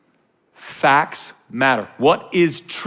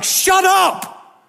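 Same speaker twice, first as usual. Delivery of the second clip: high, loud shouted shut up; the pastor yelling at online critics; exasperated shout; 6 dB louder than usual. Retold or imitated. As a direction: burst out in fed-up anger. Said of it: "That's why I lost my mind".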